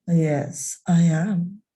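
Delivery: voz mucho más grave y fuerte; Fluffy hablando con voz que cambia. Acted. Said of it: "Your voice is changing".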